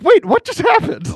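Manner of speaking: laughing, high and loud disbelief; mock bewilderment. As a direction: blurt it out in laughing shock. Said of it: "It's like".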